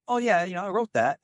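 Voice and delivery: put-on a playful, half-done British accent for the humble composer; [name]'s British accent. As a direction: slip into a teasing British accent for the quote. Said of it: "I won't do the British accent".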